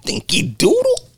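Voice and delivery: very high slow taunt; mocking Dinky Doodle. Friend repeating the bully's taunt, very high and slow. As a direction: stretch it up high and slow, teasing. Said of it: "The way he said it".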